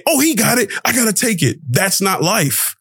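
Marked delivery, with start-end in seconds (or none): 0.0-1.6 mock greedy inner voice. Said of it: "not saying".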